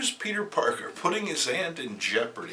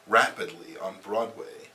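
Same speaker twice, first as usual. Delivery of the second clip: normal talking voice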